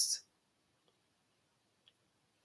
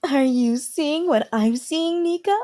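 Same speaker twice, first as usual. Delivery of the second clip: gossiping maid; 10 semitones higher, 5 dB louder, slower than usual. Acted. Gossiping maids watching.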